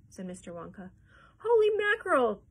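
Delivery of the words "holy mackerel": very high, loud exclamation; shocked cry. A narrator's reading. Mister T V learning of the other elevator, high shocked cry. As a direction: burst out in shrieked astonishment. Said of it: "cried Mister T V".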